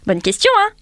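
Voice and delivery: high, loud playful challenge; teasing. Real, not acted. Host teases listeners about the paradox in a high, loud cheeky voice. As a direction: poke the listeners with a cheeky question.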